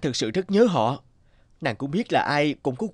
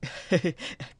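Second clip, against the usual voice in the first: quiet breathy chuckle; 3 semitones higher, 6 dB quieter than usual. Acted. He thanks her, relieved.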